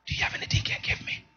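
hushed imitation of sister begging; whispered plea. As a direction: whisper it like a sheepish request. Sister asking for money, whispered.

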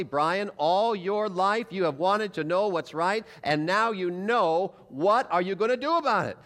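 slow, strong, pressing inner voice; insistent voice of conscience. Retold or imitated. Preacher voices the returning conscience, slow and strong. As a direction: press each word like a conscience that won't quit. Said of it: "very, very strongly".

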